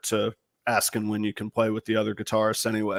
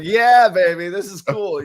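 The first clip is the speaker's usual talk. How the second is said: thrilled reply; 6 semitones higher, 6 dB louder, slower than usual. Retold or imitated. His own thrilled reply.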